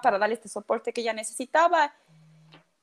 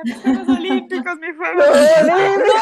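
retold crying wail; 5 semitones higher, 11 dB louder than usual. Athlete crying over her lost Olympics, mock wailing. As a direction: imitate yourself bawling, half laughing, louder on the repeat. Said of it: "lloraba y decía".

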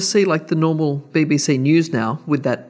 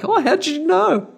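posh surprised reply. Retold or imitated. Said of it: "And they're like".

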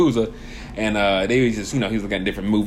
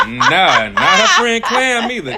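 high, loud protest through laughter; laughing, high-pitched objection; laughing refusal; 9 semitones higher, 4 dB louder than usual. Real, not acted. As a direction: crack up while refusing emphatically. Joking refusal, both laughing.

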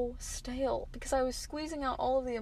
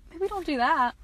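mock scolding repeated; 5 semitones higher, 8 dB louder than usual. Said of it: "I'd probably be like".

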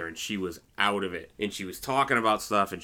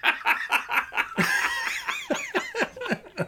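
long high peal of laughter. Real, not acted. Host cracking up at his grandmother's lie, long high laugh. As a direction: crack up at the punchline.

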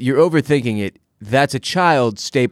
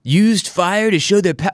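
higher-pitched mimicking of confused viewers; mock confused fans; 7 semitones higher than usual. Host quoting confused show fans, higher mock voice. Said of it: "They were like".

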